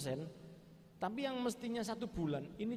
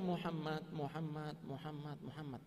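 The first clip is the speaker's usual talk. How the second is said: repeated name fading softer and lower; soft repeated calling; 3 semitones lower, 6 dB quieter than usual. Retold or imitated. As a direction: imitate a prayer that dwindles away. Retold prayer calling the Prophet's name. (menirukan doa lirih yang makin pelan)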